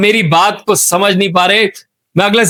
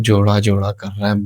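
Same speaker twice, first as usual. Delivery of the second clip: very low, soft grumble; muttered aside; 13 semitones lower, 5 dB quieter than usual. Acted. Interviewer remarks to himself about guest, low soft mutter. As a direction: mutter it under your breath.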